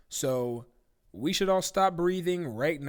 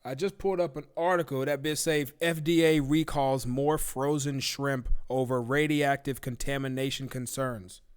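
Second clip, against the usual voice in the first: usual speaking voice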